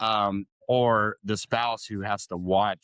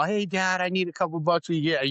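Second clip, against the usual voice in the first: put-on higher-pitched voice of a kid asking Dad; casual, pitched-up pleading; wheedling kid; 6 semitones higher than usual. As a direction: lightly imitate a kid hitting up a parent. Voicing his kid asking for money.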